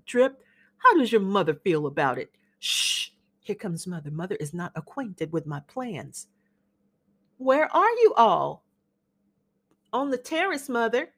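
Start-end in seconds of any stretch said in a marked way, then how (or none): 2.6-3.1 sharp unvoiced shh; warning hush as Mother approaches. Hushing as Mother comes. 3.4-6.3 low, much softer warning; softer, low confiding aside to the guest; hushed aside. Acted. Lowered voice as Mother arrives. 7.4-8.6 higher, louder, slow Southern mother's call; gracious mother calling for the young men; Amanda calling out. Acted.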